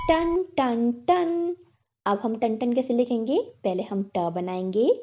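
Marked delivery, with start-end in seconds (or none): 0.1-1.6 ringing "टन, टन, टन" higher, like a bell; bell sound. Shown as a demonstration. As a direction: sing out the bell's ding. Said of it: "सुनो तो ज़रा".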